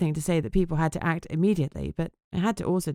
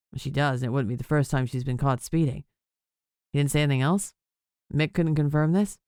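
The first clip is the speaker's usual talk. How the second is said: a concerned boss, pitched lower; lightly voiced man, deeper; lower male voice; 3 semitones lower than usual. Whip questioning.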